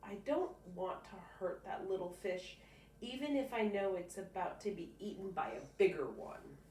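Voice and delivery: ordinary delivery, nothing marked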